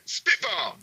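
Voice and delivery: high, hyped-up segment shout; calling out. Pretend. Guest agrees to a spitball round, calling it out.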